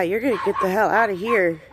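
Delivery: usual speaking voice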